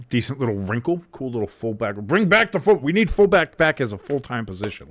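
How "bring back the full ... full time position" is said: louder, higher excited outburst; rally loudly for a favourite cause; fired-up plea. Real, not acted. Fired-up plea during game.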